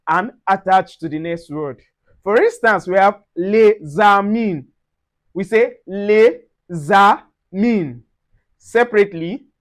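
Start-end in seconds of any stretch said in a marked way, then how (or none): 3.3-4.6 slow "les amis", S linked as a Z; linked pronunciation. Shown as a demonstration. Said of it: "The S sound like a Z". 5.9-8.0 slow "les amis", S linked as a Z; linked pronunciation. Shown as a demonstration. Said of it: "together it is pronounced les amis".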